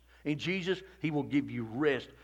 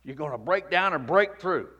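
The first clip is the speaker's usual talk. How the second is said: normal talking voice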